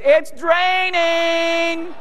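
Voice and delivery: very high, long drawn-out holler; mock yell. Wife yelling down to husband at the pipe, high stretched mock shout. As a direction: imitate a wife shrieking down from upstairs. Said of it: "wife yells from upstairs".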